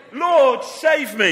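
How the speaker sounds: loud, high plea for rescue; crying out. A narrator's reading. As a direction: cry out dramatically as a sinking man, storytelling. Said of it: "He cried".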